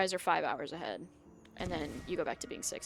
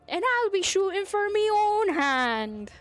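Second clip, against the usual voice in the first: high, loud, slow Scottish accent from a Disney film; 12 semitones higher, 12 dB louder, much slower than usual. Shown as a demonstration. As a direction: belt it out slow in a Scottish brogue, higher and louder. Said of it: "The only thing I know how to say in an Irish accent, no, Scottish accent is".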